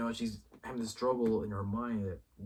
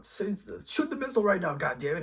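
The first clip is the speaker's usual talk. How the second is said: louder, higher order to fire; barked order; 8 semitones higher, 5 dB louder than usual. Retold or imitated. Hayward ordering the missile strike, shouting. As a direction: yell the command to shoot now. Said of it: "Hayward's like".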